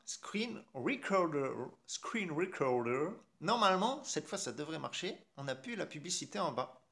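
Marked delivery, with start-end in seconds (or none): 0.1-3.1 slow, exaggerated English pronunciation; mock English accent, very slow; put-on English accent. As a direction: draw out the English words in a hammed-up accent. Hams up the English app name.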